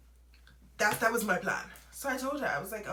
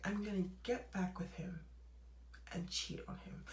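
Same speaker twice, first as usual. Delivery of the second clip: conspiratorial hushed whisper, much softer; 12 dB quieter than usual. Retold or imitated. Telling her friend the cheating revenge plan, a sly whisper. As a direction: let the friend in on a secret.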